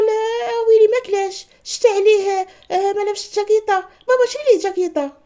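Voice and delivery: high, loud mock worry; hammed-up fretting. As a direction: whine in a high, loud, fussing voice. Sister fretting over the boy. (قلق مبالغ فيه بصوت عالي)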